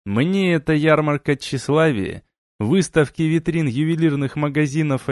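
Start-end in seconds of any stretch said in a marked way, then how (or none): none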